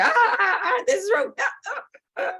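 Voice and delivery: high, laughing mock wails; high laughing mock cries; mock anguished cries. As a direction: wail high like it physically hurts. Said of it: "And I was like".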